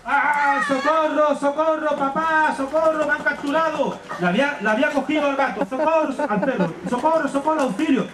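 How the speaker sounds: a trapped dog yelling socorro; crying for help. Acted. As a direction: call out for papa in a fright. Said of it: "el perro decía esto".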